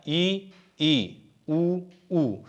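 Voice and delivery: ई and ऊ held with mouth nearly closed; vowel demo. Shown as a demonstration. Teacher showing students the closed vowels ई and ऊ. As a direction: sound ई ई then ऊ ऊ with a narrow mouth. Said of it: "कहने का मतलब मुख द्वार क्या होता है?".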